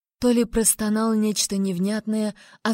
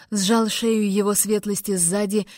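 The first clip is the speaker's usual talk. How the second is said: usual speaking voice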